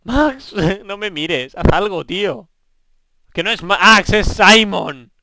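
loud, high-pitched calling out; exasperated shouting. Real, not acted. Gamer calling idle fishing companion, exasperated shout. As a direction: yell at a useless companion.

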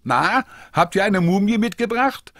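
excited high call. A narrator's reading. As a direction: shout it out gleefully. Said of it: "da ruft Julius schon".

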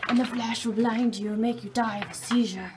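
hammed-up nature documentary host; mock tour guide. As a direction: narrate like a grand tour guide. Mock tour guide narration in cave.

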